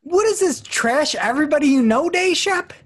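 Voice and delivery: very high, loud, squealed outrage; over-the-top high-pitched scolding; mock outrage. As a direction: squawk it sky-high in mock disgust. Mock outrage at co-host's slip.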